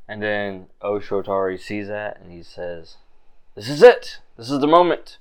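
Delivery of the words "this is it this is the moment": excited NPC. Acted. NPC excited at the key moment.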